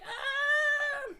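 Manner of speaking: sharp, very high retold scream; scared kid scream. Scared kid at the launch coaster station, high yelp. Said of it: "And I was like".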